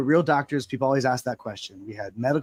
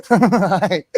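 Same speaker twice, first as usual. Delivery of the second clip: giddy, laughing hi, higher and louder; starstruck hi; 6 semitones higher, 8 dB louder than usual. Retold or imitated. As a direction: gush a nervous fangirl hi through a laugh. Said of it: "she just saw me get starstruck".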